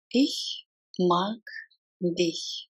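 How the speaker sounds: slow, three separated words. Shown as a demonstration. Teacher models saying I like you, word by word. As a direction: leave a gap after each word. Language drill, broken into words.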